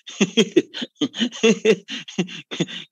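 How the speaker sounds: hearty laugh. Real, not acted.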